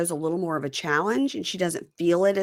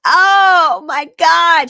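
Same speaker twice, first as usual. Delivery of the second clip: high, loud, slow shriek of joy; delighted scream; 10 semitones higher, 11 dB louder, much slower than usual. Retold or imitated. Woman thrilled by his gesture, loud high slow shriek. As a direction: squeal it out, stretched and loud. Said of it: "She screams".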